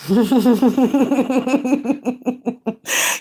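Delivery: long rattling cackle; real drawn-out laughing fit. Real, not acted. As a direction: laugh on and on in a rapid stutter. Laughs after her mocking song.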